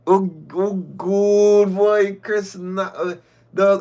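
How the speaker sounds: lightly faked sex moans, drawn out; a half-hearted moan, slow; mock pity moans. Mocking her own fake moans to Chris, drawn out. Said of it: "pity moans".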